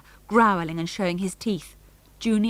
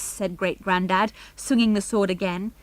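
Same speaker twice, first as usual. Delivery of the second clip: usual speaking voice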